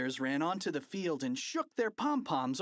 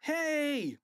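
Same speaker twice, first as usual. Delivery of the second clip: high, punched holler; fan's shout; 14 semitones higher, 4 dB louder than usual. A narrator's reading. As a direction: shout it high across the stands. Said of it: "shouted a fan in the bleachers".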